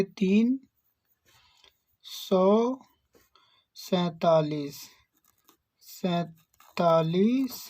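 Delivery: "सैंतालीस" drawn out very slowly while writing; slow dictation. Shown as a demonstration. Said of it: "तो यहाँ पे लिखेंगे".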